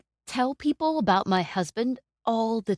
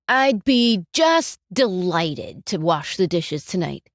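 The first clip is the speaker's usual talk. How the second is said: slow, louder, dripping sarcasm; sarcastic; 4 dB louder than usual. Shown as a demonstration. Grudgingly offering to wash the dishes, sarcastic. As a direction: lean on just and mock the offer. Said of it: "one is very sarcastic".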